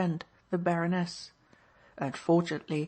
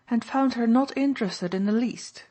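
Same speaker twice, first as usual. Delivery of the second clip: slightly cross, higher and louder; curt, mildly annoyed society lady; a little crossly; 5 semitones higher, 5 dB louder than usual. A narrator's reading. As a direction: answer with a touch of irritation. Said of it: "a little crossly".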